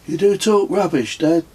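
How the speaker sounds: put-on a boy ribbing his dad; cheeky child. A narrator's reading. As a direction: son teasing his father, cheeky kid voice. Child Kez teasing father.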